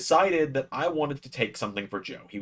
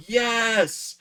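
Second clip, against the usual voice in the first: retold yell; 14 semitones higher, 5 dB louder than usual. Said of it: "you can hear me yell".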